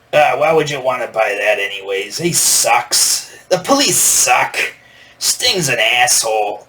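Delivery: put-on dismissive New York accent, slowing down at the end; mock-grumpy New York accent, lightly done. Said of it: "That's how the guy talked".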